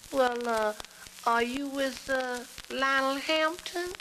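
high, hesitant, stretched-out jazz-cat voice; hip jazz musician. Acted. Musician asking his friend about bands, hip jazz cat. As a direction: search for the name in a high drawl. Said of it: "the conversation went like this".

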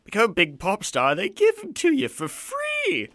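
loud film-quote impression, soaring high; Bill Nighy. Host quotes a Love Actually line in a loud impression. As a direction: start big, then draw out the last words high. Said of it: "did I use the Bill Nighy line".

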